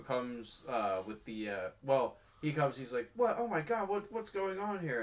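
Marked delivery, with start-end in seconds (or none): 3.2-5.0 high, alarmed what's going on; surprised shout. Retold or imitated. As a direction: act shocked and confused. Uncle barges in on the scene.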